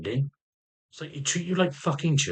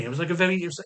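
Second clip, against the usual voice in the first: usual speaking voice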